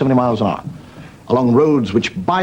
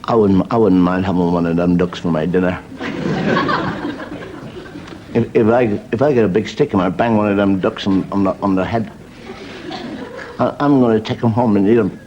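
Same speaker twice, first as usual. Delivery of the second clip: put-on a touch of drunk; 5 semitones lower than usual. Drunk man's thoughts by lake.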